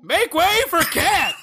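mock heroic shout for the cat character; Cat's line. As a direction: announce yourself like a brash cartoon cat. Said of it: "That's what she has to say".

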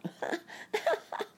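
high-pitched mocking giggle. A narrator's reading. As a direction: giggle in a squeaky little voice. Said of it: "laughed Sammy Littletail".

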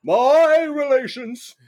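high, slow pompous orator; Colonel Starbuckle. Acted. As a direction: stretch it out like a blustering politician. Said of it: "as Colonel Cassius Starbuckle".

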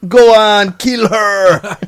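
high, overacted melodramatic cry; Tommy Wiseau. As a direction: parody an odd actor's anguished line reading. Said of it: "Say it like Wiseau, though".

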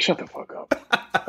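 slow whispered put-down; wife's blunt retort. Retold or imitated. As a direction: hiss it out slowly like an unimpressed wife. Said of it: "she'll just be like".

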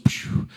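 quick airy whoosh of speed; a voiceless rush of breath; imitated whoosh. Missionary rushing through the market, imitated whoosh.

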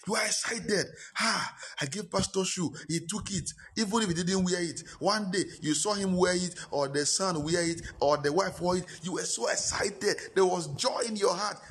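high, excited pulpit voice; mock-gleeful member giving pastor a shoe; excited preaching. Real, not acted. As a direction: relive the joy, ringing high.

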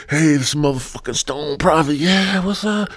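high, put-on cheery greeting from inside the jar; message in a jar. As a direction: pop out of the jar with a high hello. Voice heard from the jar.

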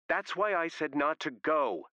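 high, slow, emphatic scolding; heated I-told-you-so; angry reproach. A narrator's reading. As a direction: hammer it out high, word by word. Sarah arguing on the phone.

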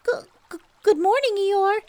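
high, loud stammering call; Piglet calling out. Acted. Piglet calling to Eeyore across the stream. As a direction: call out squeakily. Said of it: "shouted Piglet".

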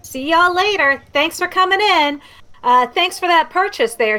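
a high, chirpy shopkeeper sing-song. Acted. Shopkeeper Templeton thanks departing customers in a high, chirpy voice. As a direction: pitch it up for a cheerful merchant.

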